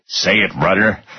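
plain reading voice

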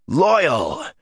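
high, delighted call; joyful exclamation. Acted. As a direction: greet an old friend gladly. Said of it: "Rand exclaimed".

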